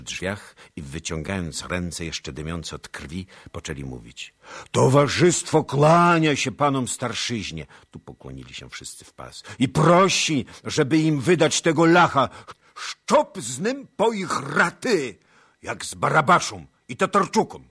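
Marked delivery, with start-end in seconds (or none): 4.7-7.7 high, loud, swaggering delegate; rough Cossack demand. A narrator's reading. Bloodied Cossacks addressing the elders, loud swagger. 9.6-17.6 high, loud, swaggering delegate; rough Cossack demand. A narrator's reading. Bloodied Cossacks addressing the elders, loud swagger.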